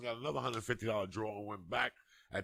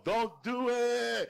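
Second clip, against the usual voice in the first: higher, louder, slow laughing call; group yell; 10 semitones higher, 6 dB louder, much slower than usual. Retold or imitated. Inmates shouting at him, laughing mock yell. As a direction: call it out like the whole row. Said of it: "all the yams would yell".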